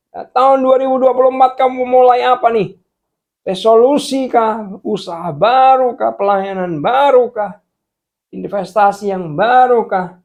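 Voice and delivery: loud, high exhortation building in intensity; full-force preaching. Real, not acted. Preacher urging the congregation to rely on God, impassioned.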